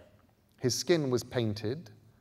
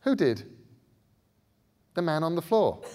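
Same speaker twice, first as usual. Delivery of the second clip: put-on higher, uncertain boy asking; boy's voice; 7 semitones higher, 5 dB louder than usual. A narrator's reading. Bod checking with Scarlet, higher and unsure. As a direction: ask her carefully. Children exchange in fear.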